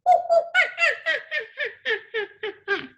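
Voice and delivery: high cheeky monkey giggle; mock the peddler like a monkey; monkey laugh. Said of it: "and said".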